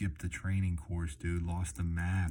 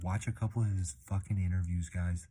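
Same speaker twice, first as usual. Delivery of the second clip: ordinary delivery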